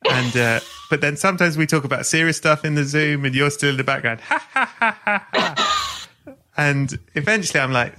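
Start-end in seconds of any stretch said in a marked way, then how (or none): 4.2-5.8 high, repeating canned ha-ha like a laugh box; mechanical laugh. Retold or imitated. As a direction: play a wind-up laugh machine, evenly spaced. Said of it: "a machine next to you that goes".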